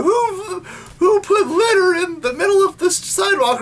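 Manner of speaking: high, put-upon cartoon girl complaining; indignant Serena voice. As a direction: play the old Serena fussing at the sidewalk. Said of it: "she'd be like".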